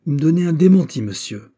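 loud, high burst, dropping on the last word; outraged cry. A narrator's reading. De Wardes given the lie by Raoul, loud outraged cry. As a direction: cry out in indignation. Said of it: "s'écria de Wardes".

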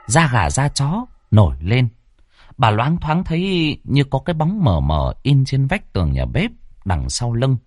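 usual speaking voice

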